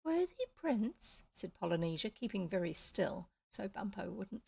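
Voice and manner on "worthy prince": put-on high, slow, small girlish voice; the parrot posing as a fairy, high and slow; fairy queen voice. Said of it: "small, high voice, like a little girl".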